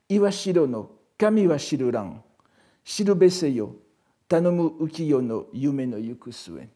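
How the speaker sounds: loud, measured verse reading; poem recitation. Acted. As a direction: declaim the verses to the class, phrase by phrase. Recites poem, then its translation.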